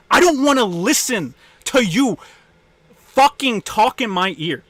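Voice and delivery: real exasperated yelling; angry shout. Real, not acted. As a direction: yell it at them, fed up. Angry rant at complainers.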